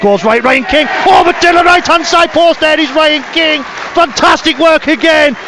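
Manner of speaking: high, loud shouted commentary as the try goes in; excited try call. Real, not acted. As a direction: roar it out like the crowd just rose.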